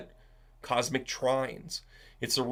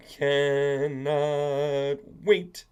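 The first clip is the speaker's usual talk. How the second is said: CANNOT WAIT stretched very slowly; very slow, elongated eagerness; drawn-out emphasis; much slower than usual. Real, not acted. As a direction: drag out each word of "Cannot wait".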